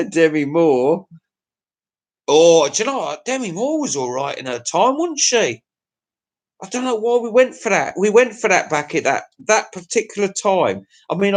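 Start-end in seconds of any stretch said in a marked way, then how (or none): none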